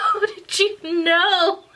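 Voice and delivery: high, slow, laughing cry of disbelief. Retold or imitated. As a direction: wail it through laughter like you're caught. Caught by mom.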